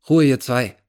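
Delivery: put-on young policeman barking for silence; barked order. A narrator's reading. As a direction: cut them off like a cop. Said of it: "herrschte mich ... Polizist an".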